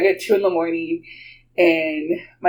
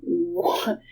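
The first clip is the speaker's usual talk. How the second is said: hushed, hesitant first reaction; stunned disbelief; 7 dB quieter than usual. Retold or imitated. Aunt reacting to the lady keeping up, stunned. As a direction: let it slip out softly in shock.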